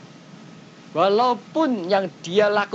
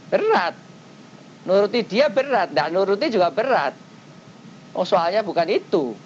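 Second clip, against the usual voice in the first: ordinary talk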